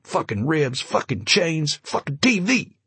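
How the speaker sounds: rising, clamoring complaints; yammering prisoners. Acted. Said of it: "all three were yammering".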